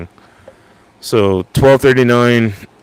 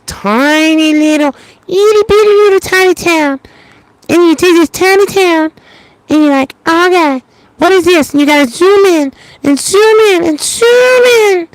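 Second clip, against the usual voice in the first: tiny squeaky voice; 3 dB louder than usual. Pretend. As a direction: squeak it out like something itty-bitty. Acting out a tiny little town.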